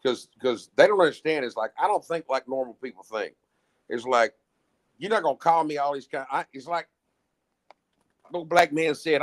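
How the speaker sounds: normal talking voice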